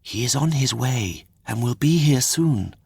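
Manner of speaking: lightly voiced reassuring fairy.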